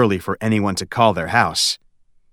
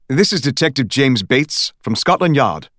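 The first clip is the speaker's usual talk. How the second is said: British detective introducing himself; detective from Scotland Yard in a British accent; 3 semitones higher than usual. Acted. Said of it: "It also had an accent".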